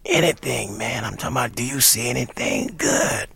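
husky, insistent rasp; imitated hoarse jazzman, slowing at the end. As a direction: press the question in a gruff rasp. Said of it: "I remember him saying something like".

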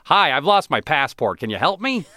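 put-on goofy American kid. Talking to the consulate staff as a high, goofy American kid. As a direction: play the big naive American tourist. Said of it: "galoot of an American kid going like".

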